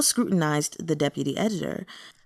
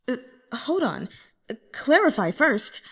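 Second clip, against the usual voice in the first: higher, lightly voiced inner panic; inner thought; 6 semitones higher than usual. A narrator's reading. Jang Yu-wan thinks in alarm, a touch flustered. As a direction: think it fast to himself, a bit flustered. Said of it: "Jang Yu-wan thought".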